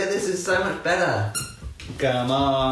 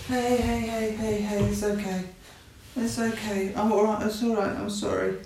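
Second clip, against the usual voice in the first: high, soft soothing murmur; calm a scared puppy gently; 6 semitones higher, 4 dB quieter than usual. Real, not acted. Owner softly soothing the frightened puppy with high hushes.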